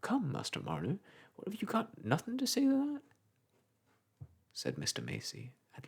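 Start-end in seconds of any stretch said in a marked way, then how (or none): 0.0-3.0 a touch of impatience, pushing for a reply; old clerk prodding the silent weaver, mildly impatient; slight impatience. Acted. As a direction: nudge him, mildly impatient. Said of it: "with a slight accent of impatience".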